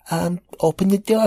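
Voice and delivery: put-on very high child's voice; little kid voice. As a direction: answer like a small boy. Nephew answers.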